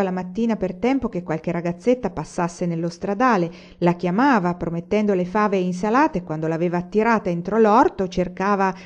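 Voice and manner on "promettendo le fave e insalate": ordinary delivery, nothing marked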